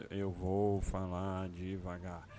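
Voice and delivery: slow mocking quote; over enunciating. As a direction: drag the words out. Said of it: "ridículo ficar falando".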